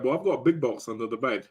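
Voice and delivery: ordinary delivery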